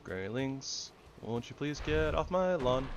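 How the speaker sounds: a lightly voiced old woman, politely pleading; polite old lady voice. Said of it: "I don't think that I could do, like, a old lady voice".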